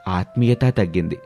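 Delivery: plain reading voice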